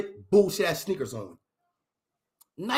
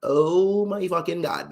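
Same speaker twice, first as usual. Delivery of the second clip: slow, stretched mock dismay; slower than usual.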